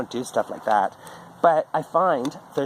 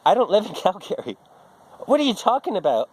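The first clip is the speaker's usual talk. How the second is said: higher, mocking sister voice with a laugh; mock sister retort; 8 semitones higher than usual. Sister correcting him about Calgary, high and mocking. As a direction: toss it back like a baffled sister.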